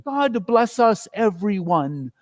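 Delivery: high, slow, thin blessing; a frail little boy's wish; thin child's voice. A narrator's reading. Tiny Tim blessing the whole family. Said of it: "ending with a thin".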